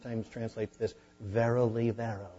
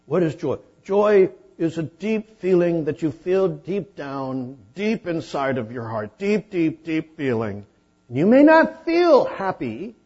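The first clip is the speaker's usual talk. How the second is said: hammed-up preacher; 8 semitones higher, 12 dB louder than usual. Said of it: "I have said in the past".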